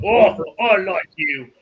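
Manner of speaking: loud, high, drawn-out delight; delighted approval. Acted. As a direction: relish it slowly and loudly, chuckle after. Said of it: "as he's chuckling".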